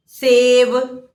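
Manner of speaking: Gita drawn out very slowly, syllable by syllable; slow classroom enunciation; slow teaching demo. Shown as a demonstration. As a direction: spell out Gita slowly for children. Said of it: "ए की मात्रा के शब्द क्या होंगे".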